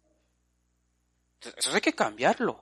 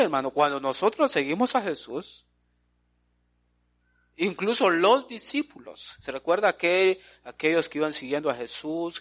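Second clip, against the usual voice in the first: normal talking voice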